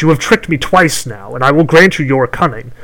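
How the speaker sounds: loud, menacing crone voice; threatening hag. Acted. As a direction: threaten him like a vengeful witch.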